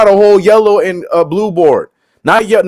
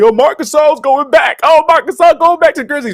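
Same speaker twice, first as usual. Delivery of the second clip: high, mock-cheerful onlooker voice; naive onlooker; 10 semitones higher than usual. Mocking outsiders who think it's a homecoming, in a high naive voice. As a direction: play the clueless fan, pitched up. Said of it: "everybody's looking at this like".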